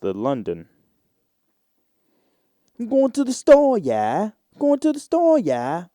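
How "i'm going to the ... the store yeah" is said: mock Cockney, much higher and louder; Cockney accent. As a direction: put on a hammed-up Cockney accent. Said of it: "Try and get that Cockney accent".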